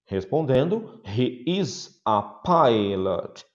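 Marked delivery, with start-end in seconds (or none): 1.1-3.4 slow model answer. Shown as a demonstration. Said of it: "slow model answer".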